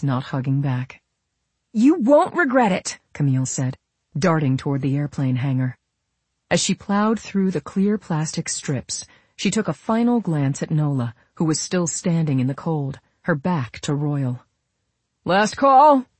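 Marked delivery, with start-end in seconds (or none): 1.7-3.0 high, louder, delighted; excited promise. A narrator's reading. Camille promising Nola as she darts off, excited. 15.2-16.1 high, loud final call; shouted. A narrator's reading. Airman with clipboard announcing boarding, shouted. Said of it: "the airman with the clipboard shouted".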